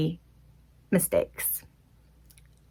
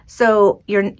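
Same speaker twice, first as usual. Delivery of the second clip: usual speaking voice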